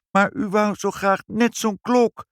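put-on high-pitched little-girl protest; protesting child. Child defending her wish to mother, protesting. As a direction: plead your case in a child's high voice.

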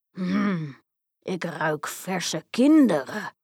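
slow, menacing storybook giant; giant's voice. A narrator's reading. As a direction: sniff out your prey as a giant, drawing the words out. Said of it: "riep hij". (trage reuzenstem)

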